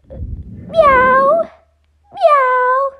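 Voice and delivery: high meowing like a cat. Acted. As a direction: meow like a kitten. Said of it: "You're not a cat".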